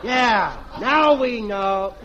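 lightly voiced bratty little boy; a hint of a sassy kid talking back to his mother. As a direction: play a cheeky little brat, a touch childish. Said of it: "I'll be the mean little kid".

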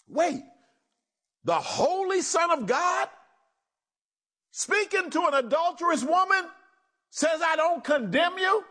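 high, amazed disbelief; astonished. Real, not acted. Preacher amazed that Jesus spares the adulteress, rising high. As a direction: marvel at it aloud.

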